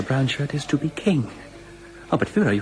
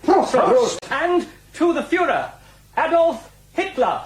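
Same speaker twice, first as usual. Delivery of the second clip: rousing toast; 12 semitones higher than usual. Acted. Group toast to the Führer.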